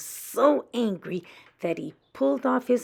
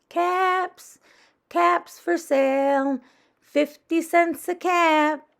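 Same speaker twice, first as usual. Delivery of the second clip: loud, slow street cry, higher; peddler calling out; 10 semitones higher, 8 dB louder, slower than usual. A narrator's reading. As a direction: call out the wares to the street. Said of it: "he called".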